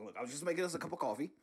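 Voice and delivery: usual speaking voice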